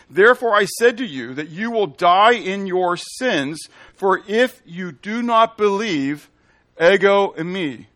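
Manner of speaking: slow, loud proclamation; solemn pulpit declaration; preaching scripture. Acted. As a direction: lean on every word as a warning. Proclaims verse slowly.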